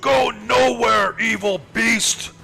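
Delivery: high, slow, loud heroic shout; Halvard. Acted. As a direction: bellow a defiant challenge at the beast. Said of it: "As Halvard says".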